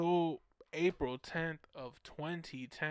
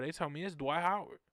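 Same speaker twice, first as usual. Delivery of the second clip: ordinary talk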